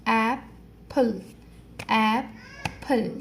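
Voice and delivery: Apple said very slowly as ای-پل, each beat apart; slow syllable clapping. Shown as a demonstration. Teacher showing children how to clap each syllable, very slow. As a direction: split apple into two slow beats. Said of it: "clap here two times".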